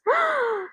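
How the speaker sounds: very high acted gasp; exaggerated gasp from off in the distance; hammed-up high intake of breath; distant gasp. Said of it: "Jeremiah gasps".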